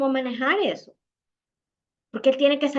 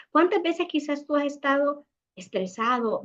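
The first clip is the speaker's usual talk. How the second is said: ordinary delivery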